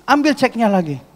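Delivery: mock angry order; retold angry demand. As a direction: imitate a client angrily taking it back. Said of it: "Marah-marah dia".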